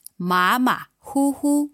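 马马虎虎 said with clear Mandarin tones. Shown as a demonstration. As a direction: model 马马虎虎 for the learner. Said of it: "expressions like".

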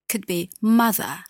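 ordinary delivery

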